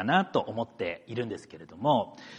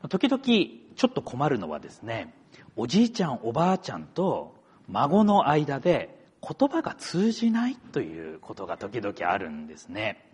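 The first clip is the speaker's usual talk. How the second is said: ordinary talk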